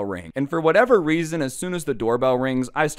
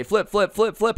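higher, chanted urging, retold; egging on; 7 semitones higher than usual. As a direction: imitate pals chanting to egg him on. Friends egging him on.

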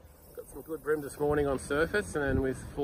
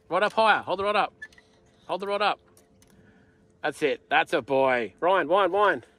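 shouted coaching; 8 dB louder than usual. Real, not acted. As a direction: bark orders across the boat. Coaching a kid fighting fish.

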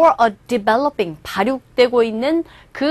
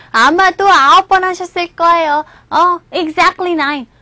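put-on higher, louder voice of a cheeky child; pointedly precise son; 5 semitones higher, 5 dB louder than usual. Son correcting mother on how many times, pointedly precise. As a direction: pipe up with an exact correction. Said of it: "꼭 집어서 말하는 아들".

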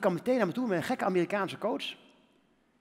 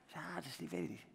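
hesitant answer; 5 semitones lower, 16 dB quieter than usual. Retold or imitated.

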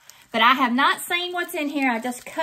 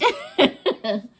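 amused laugh; 6 dB louder than usual. Real, not acted. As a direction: giggly chuckle. Laughs at herself.

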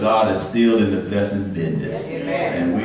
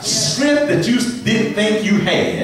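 high, climbing sermon cry; preacher climbing into a high chant; rising preacher whoop; 3 dB louder than usual. Real, not acted.

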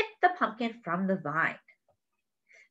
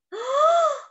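high, startled intake of breath; real surprised gasp at the picture; sharp gasp; 5 dB louder than usual. Real, not acted. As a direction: gasp as if it really happened. Reader reacts to rolling pumpkin.

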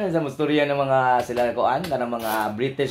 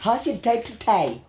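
higher, halting British impression; badly faked British English; mock British accent; 6 semitones higher than usual. Host showing how British people talk, mock British accent. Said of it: "mga British".

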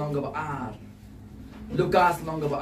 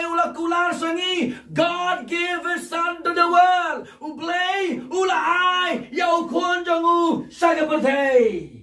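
high, ringing proclamation; full-force preaching; 12 semitones higher than usual. Real, not acted. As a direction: proclaim it to the whole congregation.

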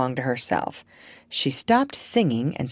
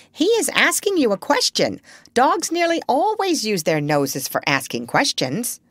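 high parrot explaining; high-pitched, emphatic parrot; know-all parrot; 6 semitones higher than usual. A narrator's reading. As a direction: lecture the doctor in a bird voice.

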